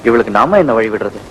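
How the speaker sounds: mock her line in a low, sneering voice; mocking echo. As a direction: throw her own words back at her in scorn.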